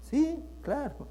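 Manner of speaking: high, dry mock agreement; an ironic wink at the audience; sarcastic aside. Pastor teasing the congregation, sarcastic aside.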